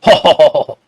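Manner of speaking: mock crowd laughing ho ho ho; imitated crowd laughter. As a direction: copy a laughing audience, one ho at a time. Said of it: "कितना crowd हंस रहा हो". (हो हो हो करती हंसती भीड़ की नकल)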